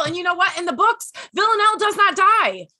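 high, loud, heated rant; angry rant. Real, not acted. Fan ranting to friends about a killed character, angry. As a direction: vent real fury about the show. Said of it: "I'm pissed off about it".

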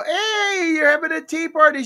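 high, loud cooing surprise; delighted dad. Retold or imitated. Dad walking in on daughter's tea party, delighted. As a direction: gush at a child's game. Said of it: "And I go".

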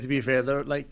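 normal talking voice

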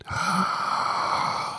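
sharp unvoiced gasp of disbelief; shocked gasp. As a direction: gasp in mock horror. Shocked reaction to question.

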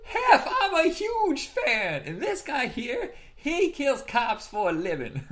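slow, high mock fan greeting; hammed-up laughing impression. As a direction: stretch it out high while laughing. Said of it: "quoting Axel at mansion".

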